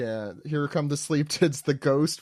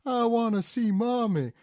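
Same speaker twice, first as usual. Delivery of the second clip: put-on whiny kid; 9 semitones higher than usual. Crying child voiced.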